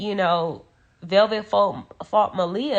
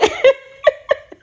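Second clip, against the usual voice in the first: fond laugh; 10 dB louder than usual. Real, not acted. Fond memory of sister.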